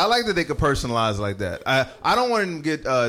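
usual speaking voice

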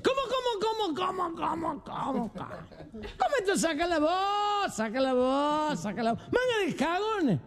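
put-on high, slurred drunken heckler, dragging the vowels. Drunk man butting in on who gets the voice, drunken high squawk. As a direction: rant in a high, stretched-out drunk voice. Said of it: "Y un borracho dice".

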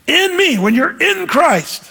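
"in" pitched high in "In me" and "in Christ"; slow, high emphasis on each "in"; stress on "in". Shown as a demonstration. Preacher stressing the preposition, "in" lifted high both times. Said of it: "the preposition there is huge".

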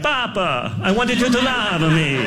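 high-pitched, hammed-up Italian accent. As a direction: plead like a caricature Italian son, pitched high. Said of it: "You should be like".

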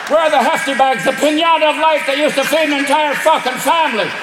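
lightly Irish raccoon rant; a hint of Irish in an angry raccoon. Raccoon ranting at the recycler in mild Irish. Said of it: "I made the raccoons Irish".

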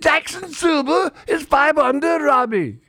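very high, hammed-up English accent; mock English accent. Shown as a demonstration. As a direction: report the score in a bad English accent. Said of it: "That's how they".

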